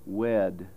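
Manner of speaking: slow "wed", vowel gliding toward schwa; long diphthongized vowel. Shown as a demonstration. Phonetician showing long vowel four, "wed" drawn toward schwa. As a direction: stretch "wed" into "weh-uhd". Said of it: "especially if it's long".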